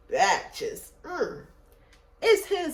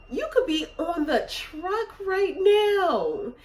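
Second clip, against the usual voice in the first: indignant scolding; 6 semitones higher than usual. Retold or imitated. Retold quarrel with entitled guest.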